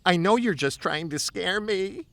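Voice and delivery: a hint of a frightened friend protesting; lightly voiced nervous kid; scared friend's voice. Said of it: "They'd bellow out things like".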